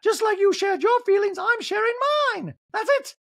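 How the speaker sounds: very high, forceful impression; loud-edged theatrical high-pitched retort; Dorit. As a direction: insist in a piercing put-on high voice. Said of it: "So Dorit goes".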